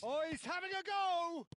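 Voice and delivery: high, loud rising excitement; excited call. Real, not acted. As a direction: lift the voice as he shoots. Shot from distance called.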